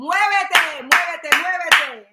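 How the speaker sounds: loud, drawn-out Spanish urging; fired-up urging. Acted. Host urging listeners to move in Spanish, fired-up rallying. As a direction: chant it to get them moving, emphatically.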